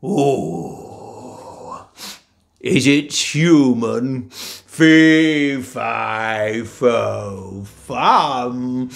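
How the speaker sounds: slow, loud sniffing giant; menacing giant. Acted. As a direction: savour the scent of blood. Giant smells human.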